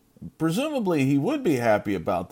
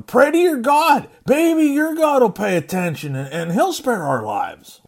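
desperate captain's plea; 12 semitones higher, 7 dB louder than usual. Acted. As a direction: urge him like your life depends on it. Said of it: "he tells him".

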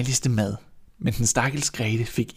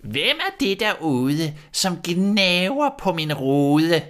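put-on slow, thin old voice calling from inside; a witch calling out to the children outside; thin witch's voice; 7 semitones higher, slower than usual. A narrator's reading. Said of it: "en tynd stemme, der råbte". (tynd, langsom heksestemme)

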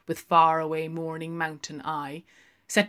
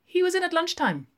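put-on quick, much higher young barmaid; 14 semitones higher, 6 dB louder, faster than usual. Barmaid answering Lenehan, high and quick. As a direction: pert barmaid, rattling it off.

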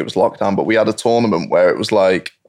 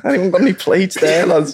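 higher imitated Scouse accent; a Scouse lad asking for plates; 8 semitones higher than usual. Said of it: "In the Scouse accent".

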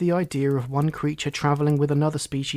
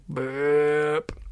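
burp-like word. A narrator's reading. Narrator imitates the big burp.

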